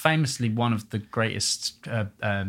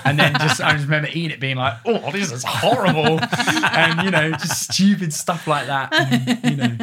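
louder, laughing while talking; 6 semitones higher, 6 dB louder than usual. Real, not acted. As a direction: laugh at own mistake. Laughing about the bad bolognese.

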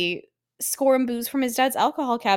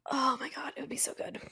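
hushed, breath-only aside; very soft, whispered yearning; longing whisper; 16 dB quieter than usual. Real, not acted. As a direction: whisper it as a private wish. Longing aside after wishing.

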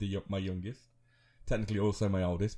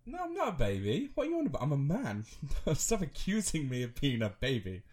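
much higher indignant protest; mock indignant son; 5 semitones higher than usual. Son protesting to his mum, high indignant squeak. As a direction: squeak it out, offended. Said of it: "I'm like".

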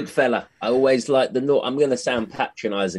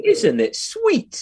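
high, slow and syrupy; drawn-out sugary cooing; mock cooing Londoner; 12 semitones higher, much slower than usual. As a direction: coo it like a patronising Londoner. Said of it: "As a Londoner going".

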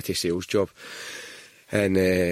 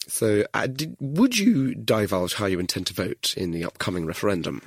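ordinary talk, nothing marked

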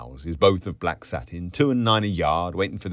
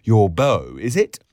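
loud, raised, teasing question; rude, unmannerly; 4 semitones higher, 8 dB louder than usual. A narrator's reading. Boots boy teasing the barmaid about a beau, loud and rude. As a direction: needle them with it, voice up. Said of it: "loud Boots unmannily asked".

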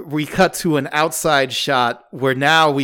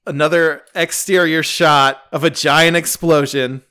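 usual speaking voice

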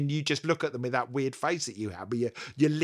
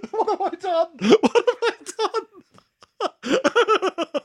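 high, loud laughing disbelief; cracking up at own words; 8 dB louder than usual. Real, not acted. Laughing at own joke gone wrong.

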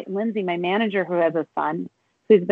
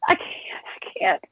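tearful whisper; 5 dB quieter, slower than usual. Real, not acted. Fighting tears, voice gives out.

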